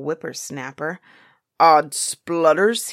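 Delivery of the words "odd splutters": loud, drawn-out exclamation; big storybook giant outburst; giant's cry. A narrator's reading. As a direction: cry it out slowly and forcefully. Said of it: "he cried".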